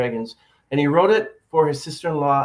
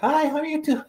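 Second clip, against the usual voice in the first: much higher, thin playback voice; recorded voice; 14 semitones higher than usual. Shown as a demonstration. Podcaster mimicking his voice on tape playback, much higher. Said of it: "but I sound like this".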